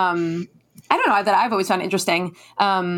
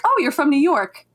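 put-on higher-pitched voice of someone placing an accent; mock recognition; 7 semitones higher than usual. People abroad hearing her accent, mock recognition. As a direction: imitate a stranger's sudden realization.

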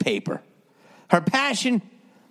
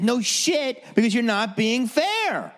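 much higher, exasperated outburst; higher mock outburst; retold outburst; 12 semitones higher than usual. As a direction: fire it back at the therapist. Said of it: "And I said".

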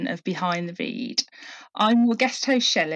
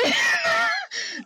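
high squealing giggle; giggling fit. Real, not acted. Host giggles after the accent attempt, high squeal. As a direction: burst into helpless giggles. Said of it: "You should see my face right now".

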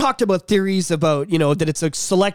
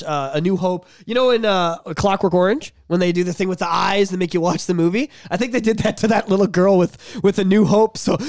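normal talking voice

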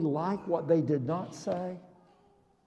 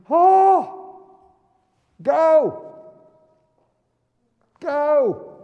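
calling out; 5 semitones higher, 7 dB louder, much slower than usual. Pretend. Jesus voiced sending lepers.

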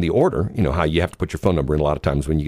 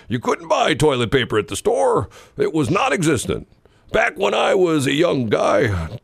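put-on old prospector; 9 semitones higher than usual. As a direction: scold the youngster like a cranky old-timer. Old man scolding a whippersnapper.

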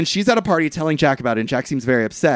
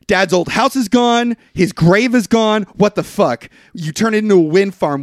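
raised, higher angry retelling; mock pissed-off; 6 semitones higher, 4 dB louder than usual. Jack confronting his brother about dad's grave, pissed off. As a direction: confront him, pitched up and indignant. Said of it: "And he's pissed".

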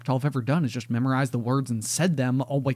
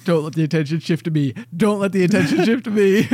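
pleading, building higher and louder; mock panicked plea; 4 semitones higher, 5 dB louder than usual. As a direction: act out a desperate inner prayer, growing louder. Acted inner plea to avoid attention.

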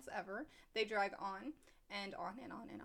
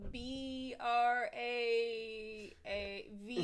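slow spelling; much slower than usual. Retold or imitated. Kid spelling slowly.

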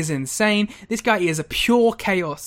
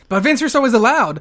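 mock promo announcement; 6 semitones higher, 6 dB louder than usual. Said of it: "And then he goes".

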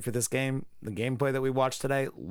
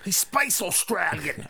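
put-on Australian accent; 6 semitones higher, slower than usual. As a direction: exaggerate a fake Australian accent, slow and high. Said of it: "don't even have an Australian accent".

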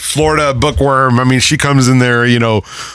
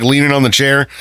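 normal talking voice

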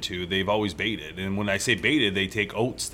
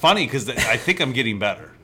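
usual speaking voice

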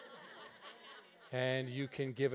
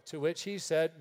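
ordinary delivery

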